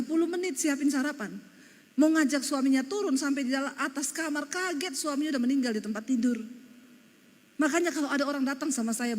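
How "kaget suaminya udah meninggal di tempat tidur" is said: ordinary talk, nothing marked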